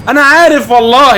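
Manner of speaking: sudden high alarmed exclamation; panicked realisation. Real, not acted. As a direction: knew it, half panicked. Realising he'll be locked in.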